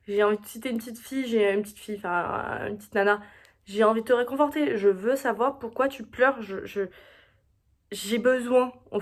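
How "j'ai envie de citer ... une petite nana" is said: ordinary talk, nothing marked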